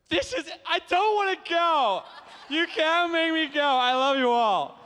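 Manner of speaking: high, loud, hammed-up wail; play-protest at being dragged offstage; mock tantrum. Mock protest at leaving the panel.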